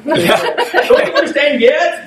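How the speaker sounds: slow, high, laughing exasperation; exasperated Jesus, laughing. Pretend. Jesus asking his disciples, slow and laughing. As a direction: drag it out in amused disbelief. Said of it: "I would imagine that's the way".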